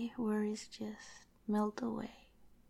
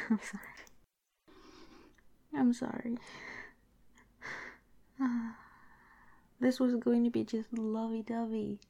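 overwhelmed with love, tearful little laughs; happy tears; 2 semitones higher than usual. Acted.

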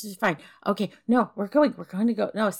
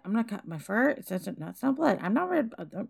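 normal talking voice

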